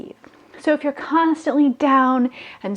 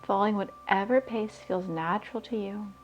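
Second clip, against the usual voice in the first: guided meditation; 9 dB quieter than usual. Acted. [name] guiding listeners to raise their vibration, soft meditation voice. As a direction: breathe the words out calmly, leaving long pauses.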